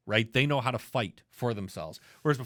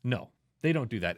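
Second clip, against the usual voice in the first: ordinary talk, nothing marked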